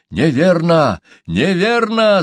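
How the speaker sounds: loud, high, fervent denial; heated exclamation. A narrator's reading. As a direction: burst out hotly. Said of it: "с горячностью воскликнул Чумбока".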